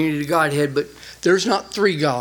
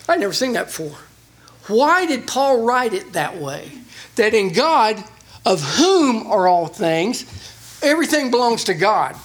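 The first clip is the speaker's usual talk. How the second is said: ordinary talk, nothing marked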